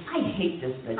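low, soft seething aside; through the teeth. Acted. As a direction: mutter it through clenched teeth. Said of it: "you say through your teeth".

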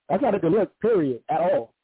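usual speaking voice

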